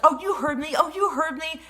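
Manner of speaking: mocking taunt. Imitating the rude woman taunting from ten feet back, high and loud.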